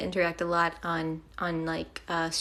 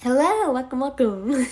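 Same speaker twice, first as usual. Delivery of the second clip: voice acting; 4 semitones higher, 6 dB louder than usual. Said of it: "We're doing some voice acting".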